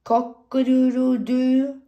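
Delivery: high, loud cock-a-doodle-doo; loud, high-pitched rooster call; cockerel crow. Acted. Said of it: "a cockerel".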